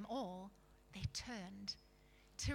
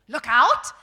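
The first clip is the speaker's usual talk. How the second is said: much louder, surly warning; 2 semitones higher, 23 dB louder than usual. A narrator's reading. Surly dwarf warning someone to look out, surly shout. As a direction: snap it out, loud and surly. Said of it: "in a surly voice".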